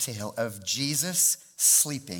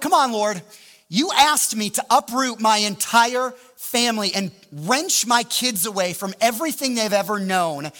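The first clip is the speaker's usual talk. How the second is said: raised, louder voice pleading with God; indignant rant; 5 semitones higher, 4 dB louder than usual. Retold or imitated. Discouraged pastor rants at God about the failed move, indignant. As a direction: pile up grievances in acted outrage. Retold angry complaint to God.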